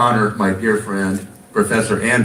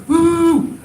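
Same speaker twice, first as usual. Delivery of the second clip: high cheering woo; excited whoop; 4 dB louder than usual. Real, not acted. Host cheering the sidekick [name] on stage, high excited whoop. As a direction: whoop it up for him.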